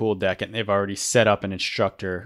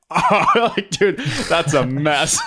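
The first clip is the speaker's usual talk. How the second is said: laughing, high-pitched imitation of a dismayed onlooker; mock-appalled reaction, drawn out through laughter; amused dismay; 8 semitones higher, 4 dB louder, much slower than usual. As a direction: retell it as a stunned, amused groan. Retelling instructor's reaction to the tangle.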